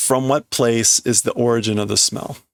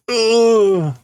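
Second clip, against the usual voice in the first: much higher, louder yelp; startled cry; 10 semitones higher, 4 dB louder than usual. Real, not acted. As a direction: yelp in fright. Scared cry.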